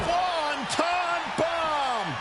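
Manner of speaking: high, hugely drawn-out announcer cry; excited, very slow high call; finisher call. Real, not acted. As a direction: stretch every word of the big move. Commentator calling the finishing move.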